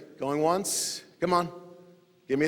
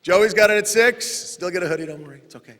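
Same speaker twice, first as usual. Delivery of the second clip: ordinary delivery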